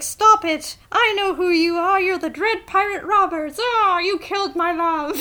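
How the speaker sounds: high, loud melodramatic wail; mock Buttercup outburst. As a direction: ham up a distraught heroine crying out. Buttercup quoted in retold scene.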